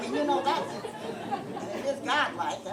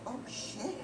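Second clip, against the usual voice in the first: muttered dismay; 8 dB quieter than usual. Real, not acted. Dismayed aside to herself.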